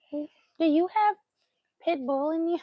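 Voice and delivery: put-on high baby-talk question; playful pet talk. Retelling her question to the big-headed stray, playful cooing. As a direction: coo it curiously at a dog. Retold question cooed to the dog.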